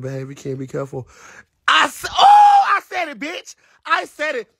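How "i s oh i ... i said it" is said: very high shriek, then softening; high-pitched crowing; gleeful outburst. Real, not acted. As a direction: shriek it, then settle into high squeaky glee. Crowing over a daring remark.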